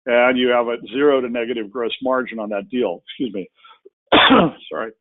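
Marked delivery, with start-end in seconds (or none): 3.0-4.9 throat catching, strained; words squeezed past a tickle in the throat; choking up. Real, not acted. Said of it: "I'm choking up".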